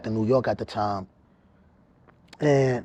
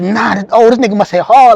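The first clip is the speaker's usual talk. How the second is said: high, loud panicked outburst; retold panic after a blow to the eye, loud and high; panicking; 6 semitones higher, 10 dB louder than usual. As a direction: blurt it in shock at the blood. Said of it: "I started panicking".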